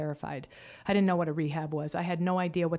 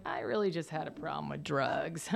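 sheepish evasion; 5 semitones lower, 7 dB quieter than usual. Retold or imitated. Evasive reply to mom's question.

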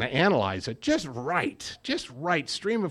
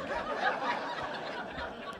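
breathy laugh; 11 dB quieter than usual. Real, not acted. Laughs at his own story.

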